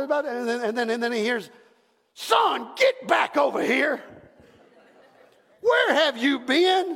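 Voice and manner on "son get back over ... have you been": loud, high call to the son; mock scolding parent's shout. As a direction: yell for the lost kid. Parent calling the boy.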